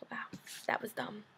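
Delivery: whispered to herself; sheepish whisper after reading aloud. Real, not acted. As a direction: say it under your breath, embarrassed.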